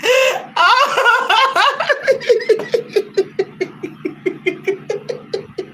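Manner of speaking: long, very high helpless laughter. Real, not acted. Long laugh at helium joke.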